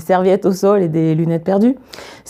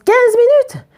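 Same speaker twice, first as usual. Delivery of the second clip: very high, emphatic call; acted exasperated exclamation; 3 dB louder, slower than usual. A narrator's reading. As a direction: throw it out as a high-pitched challenge. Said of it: "soudainement excédée".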